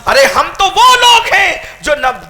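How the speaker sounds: high, loud, building sermon cry; fiery preaching. Acted. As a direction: preach it to the rafters, rising each line. Preacher challenging the crowd.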